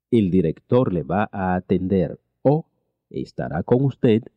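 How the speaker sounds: ordinary talk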